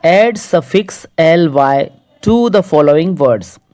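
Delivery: reads the English instruction very slowly, word by word; slow reading aloud. Shown as a demonstration. Teacher slowly reading the next exercise to pupils. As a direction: read the task out slowly for the class.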